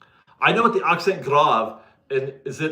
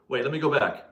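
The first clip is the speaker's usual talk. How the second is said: ordinary talk, nothing marked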